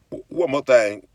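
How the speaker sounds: ordinary delivery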